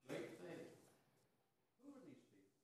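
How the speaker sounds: normal talking voice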